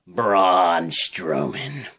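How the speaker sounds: slow, low drawn-out ring announcer call; mock ring announcer. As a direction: boom out the entrance like an arena announcer. Announcing wrestler entrance.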